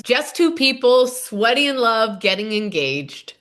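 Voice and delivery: ordinary delivery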